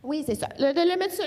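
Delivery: ordinary talk, nothing marked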